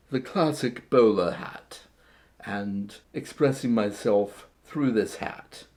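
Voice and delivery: a lightly done posh British gentleman accent, badly faked; mock British gentleman accent, not quite landing; terrible British accent. Vlogger showing off his bowler hat in a bad British accent. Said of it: "that was a terrible accent".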